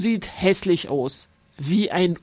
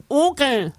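sly nasal creature; 6 semitones higher than usual. A narrator's reading. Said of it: "zwinkert Hotte verschmitzt zu".

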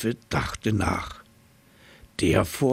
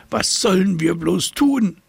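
high, louder worried cry; desperate; 10 semitones higher, 4 dB louder than usual. A narrator's reading. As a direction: cry out helplessly, pitched up. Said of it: "schnaubte das Nilpferd".

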